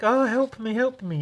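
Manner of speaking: high, mock pleading cry for help; damsel in distress. Retelling his comic, heroine begs for help in a high mock voice. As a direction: squeak it like a helpless damsel. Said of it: "so she's like".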